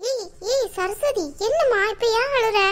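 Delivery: high-pitched, dismayed calling out; alarmed concern. Acted. Relative to crying Saraswathi, alarmed concern. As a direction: call to her in worried surprise, pitched high.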